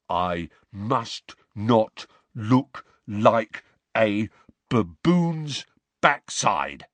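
very slow, dim grunting voice; Goyle's grunt. Acted. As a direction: drag each word like a slow-witted thug. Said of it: "He lowered his voice to Goyle's low grunt".

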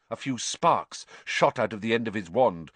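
ordinary delivery

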